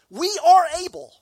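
slow, high, loud mock boast, leaning on are; boastful disciples. Preacher mimicking disciples answering Jesus, slow and loud. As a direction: drag out the brag.

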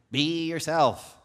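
emphatic genie impression; Genie from Aladdin. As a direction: stretch it out like a movie quote. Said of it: "as the genie taught us".

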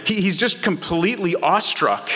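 usual speaking voice

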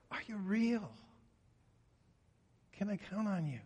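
pleading prayer. Acted. David's desperate plea voiced.

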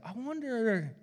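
high, pondering "I wonder"; preacher wondering during sermon prep; musing voice. Retold or imitated. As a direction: lift it into a curious musing. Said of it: "I was like".

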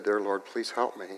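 softly, a hushed plea to God; soft retold prayer; quiet prayer. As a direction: pray under the breath. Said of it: "a quiet prayer".

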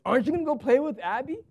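a fed-up wife nudging her husband; exasperated wife. Retold or imitated. Pastor imitates his wife urging him to join the tea party, exasperated. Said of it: "in a very exasperated voice says".

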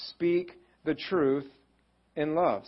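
slow, weighted speak the truth in love; slow scripture. Acted. Preacher reading Ephesians to the congregation, slowly. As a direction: lay each word down slowly.

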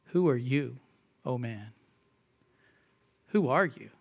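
quiet, low, solemn "Who are you, oh man"; quiet solemn scripture reading; hushed rebuke. Acted. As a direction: let the rebuke sink low. Quoting Paul's rebuke, hushed.

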